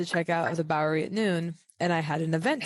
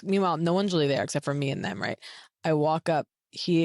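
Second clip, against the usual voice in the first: usual speaking voice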